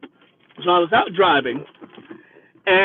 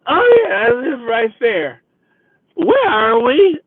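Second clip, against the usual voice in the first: put-on high, slow, groggy drunk voice; a drunk waking up confused; mock drunk, high and dragged out; drunk woman waking; 5 semitones higher, 3 dB louder, slower than usual.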